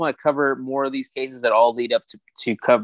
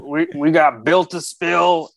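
put-on joking country accent; 4 semitones higher, slower than usual. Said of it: "I don't know why I'm doing the redneck voice".

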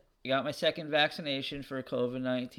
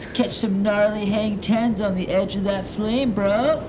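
loud laid-back surfer bro; surfer dude; 7 semitones higher, 9 dB louder than usual. Acted. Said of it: "the surfer voices".

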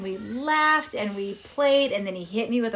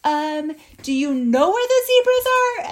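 higher, louder mock-nervous asking; tentative question; 7 semitones higher, 6 dB louder than usual. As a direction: ask the hyenas with a touch of unease. Timid question to hyenas.

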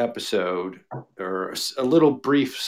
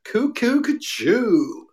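cuckoo call; 12 semitones higher, much slower than usual. Pretend. Beatles reference sound effect.

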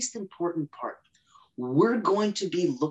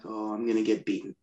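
laughing; 10 semitones lower than usual. Retold or imitated. Retold own reaction.

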